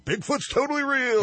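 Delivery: high, excited, hammed-up believer; mock believer squeal. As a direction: squeal it like a gullible fan. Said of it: "'cause physics professors are just all".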